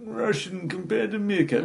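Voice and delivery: high-pitched Russian meerkat; sing-song cartoon Russian; mock Russian accent. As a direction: imitate a Russian advert meerkat. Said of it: "sort of accent".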